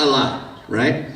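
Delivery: usual speaking voice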